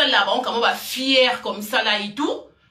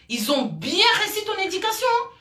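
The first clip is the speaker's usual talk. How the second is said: much higher, mocking "Ils ont bien réussi ton éducation"; biting sarcasm, voice jumping up; sarcastic; 8 semitones higher than usual. Real, not acted. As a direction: drip scorn with a pitched-up fake compliment. Same line repeated, sarcastic.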